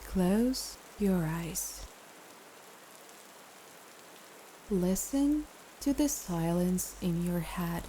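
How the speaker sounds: calm meditation guide with long pauses; guided meditation. Acted. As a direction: ease the listener into rest, phrase by phrase.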